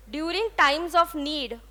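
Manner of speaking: slow, word by word for writing down; dictation pace. Shown as a demonstration. Teacher dictating a phrase to the class, slowly. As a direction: spell it out slowly so they can write. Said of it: "write down".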